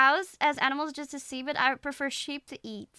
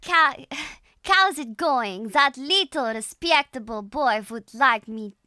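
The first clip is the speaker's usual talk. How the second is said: slow, heavy Russian accent; 7 dB louder than usual. Shown as a demonstration. Streamer copying the tutorial's practice sentence, slow Russian accent. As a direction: draw each word out in a Russian accent. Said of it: "where we get the Russian accent sound".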